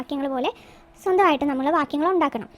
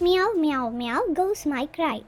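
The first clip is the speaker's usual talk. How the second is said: slow poem dictation; much slower than usual. Shown as a demonstration. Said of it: "നമുക്കതൊന്ന് എഴുതി നോക്കാം".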